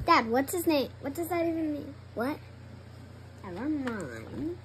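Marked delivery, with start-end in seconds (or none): 3.4-4.6 drawn-out never mind. Real, not acted. Daughter gives up.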